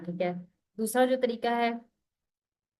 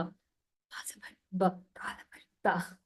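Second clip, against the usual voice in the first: whispered slow spelling; spelling under breath; 4 dB quieter, much slower than usual. Retold or imitated. Teacher imitating a student spelling silently, whispered. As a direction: whisper the spelling to yourself, slowly. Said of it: "वो पहले दिल में spelling करते".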